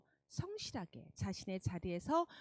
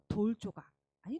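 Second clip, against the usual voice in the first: usual speaking voice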